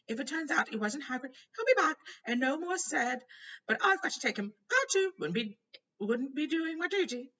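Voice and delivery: high, loud flustered minister. A narrator's reading. Fudge pleading with Dumbledore, high and loud. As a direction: plead like a harried official. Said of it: "said Fudge".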